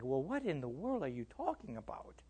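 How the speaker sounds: very soft, incredulous grumble; baffled aside. Retold or imitated. Preacher answers talk of intimacy with God, baffled aside. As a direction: keep the bewilderment under your breath.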